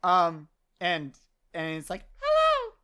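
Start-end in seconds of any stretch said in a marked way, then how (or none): 2.2-2.7 cute, very high-pitched creature voice; Poofy. Acted. Furball introduces itself to Amber in a cute squeak. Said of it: "cute voice, quote unquote".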